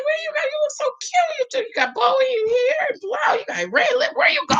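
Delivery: very high, whiny husband questioning; husband's voice. Retold or imitated. Husband teasing his wife about her bow and red lip, very high. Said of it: "He said".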